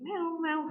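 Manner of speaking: high-pitched cat meowing; imitated cat meows. Shown as a demonstration. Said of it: "antum mendengar suara kucing". (tiruan suara kucing mengeong)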